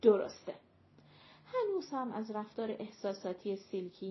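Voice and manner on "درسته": curt agreement. A narrator's reading. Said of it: "گملوتی جواب داد".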